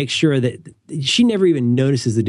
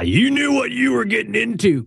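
a hint of a self-justifying boyfriend, pitched higher; lightly voiced smug boyfriend, higher; that guy; 10 semitones higher than usual. Speaker mocking his old self to his cohost, light smug boyfriend voice.

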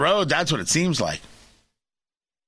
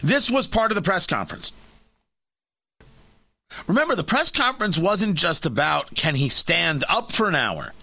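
ordinary talk

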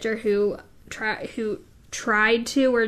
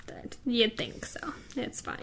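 whisper; 12 dB quieter than usual. Real, not acted. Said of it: "S1 mutters aside".

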